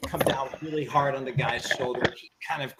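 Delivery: ordinary talk, nothing marked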